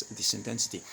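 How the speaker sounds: ordinary talk, nothing marked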